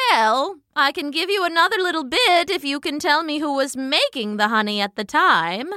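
lightly voiced, persuasive elder; coaxing old hornet. A narrator's reading. Old hornet bargaining with the ant witness, coaxing.

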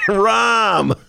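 laughing out the name; a delighted laughing reveal; blurting the name through a laugh. Real, not acted. Laughing recall of mayor's name.

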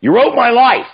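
loud, high burst of praise; effusive exclamation. Retold or imitated. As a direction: blurt it out, overwhelmed. Producer effusively praising the script.

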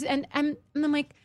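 ordinary talk, nothing marked

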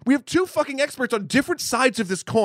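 high, eager and emphatic; excited. Real, not acted. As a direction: hype up the two experts. Excited pitch about guests.

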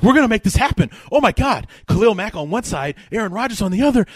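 high, loud, excited fan voice; freaking-out fan. Retold or imitated. Host mimics a Packer fan getting trade news, freaking out. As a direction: lose it with excitement over the big news. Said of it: "He freaks out".

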